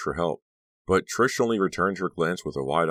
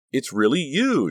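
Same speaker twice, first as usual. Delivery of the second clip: high, loud, stretched out; awed delight; 10 semitones higher, 6 dB louder, slower than usual. A narrator's reading. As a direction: marvel at a long-lost friend. Honey gushes, glued to Becca.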